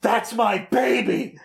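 slow, high, strained mock cry; overcome fan. Fan cheers a beloved character, hammed-up and overcome. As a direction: draw it out, overcome with pride.

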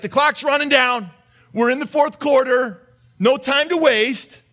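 rallying call, fourth quarter, no time to waste; urgent Peter. Retold or imitated. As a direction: push it like a coach in the final minutes. Peter voiced urging hurry.

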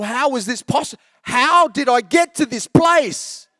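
high, slow, half-sung cry of mock despair; comic sing-song lament at his own worm obsession; exasperated lament. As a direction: wail it to the heavens, half singing. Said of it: "I'm banging my head going".

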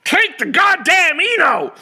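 slow, loud, high angry yell; furious dad shout. Retold or imitated. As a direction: bark the order at the kid. Father snaps back.

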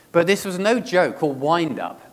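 ordinary talk

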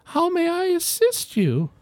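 put-on high, polite elderly lady; a genteel older woman answering her door. Homeowner greeting adventurers, high polite old lady voice. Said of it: "and she says".

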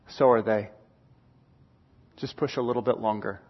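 low, soft, steady fatherly coaching; calm dad. Retold or imitated. Dad encouraging his tired son, low and calm. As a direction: drop low and quietly urge him on. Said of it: "and Dad goes".

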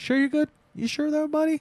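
high-pitched mock suspicion; suspicious. Vampire checking on a nervous guest, suspicious. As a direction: probe in a high, needling voice. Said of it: "the vampire's kind of suspicious".

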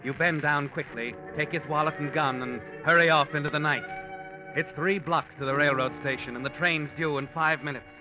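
usual speaking voice

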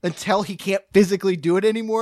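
usual speaking voice

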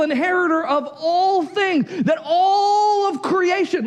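high, near-tearful preacher's shout; impassioned preaching. Acted. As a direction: cry it out as a promise of glory. Preaching at full force.

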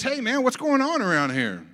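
high, loud acted question; puzzled demand. Older son hearing music asks servant, loud puzzled demand. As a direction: call out to a servant for answers.

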